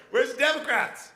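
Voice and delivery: ordinary talk, nothing marked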